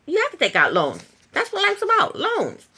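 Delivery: high, incredulous mock protest; mock outraged James. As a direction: play him shocked that anyone avoids loans. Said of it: "James was like".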